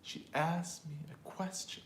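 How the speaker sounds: whispered, "asked" and "question" carry the stress; content words stressed. Shown as a demonstration. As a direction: hush it but hit "asked" and "question". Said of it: "what's stressed and what's not stressed".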